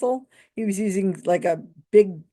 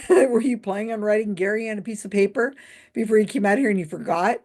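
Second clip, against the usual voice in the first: ordinary delivery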